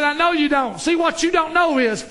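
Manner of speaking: loud, high-pitched triumphant reply; proud boy. Acted. Boy up on the boat to the old man, proud and loud. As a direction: announce it boldly, building to the reveal.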